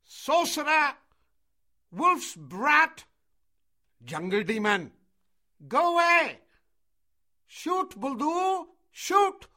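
angry mob yelling, high and very loud; villagers shouting. A narrator's reading. Villagers driving Mowgli away from the gate, furious shouting. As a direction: hurl each cry out at full volume. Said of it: "and the villagers shouted".

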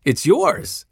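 ordinary delivery, nothing marked

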